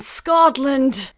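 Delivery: anguished cry. Acted. Macduff cries out for his ruined country, anguished. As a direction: hurl Scotland! out in despair.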